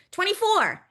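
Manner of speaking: high, loud final number. Shown as a demonstration. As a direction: call out the last number loud. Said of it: "we call that the loud number".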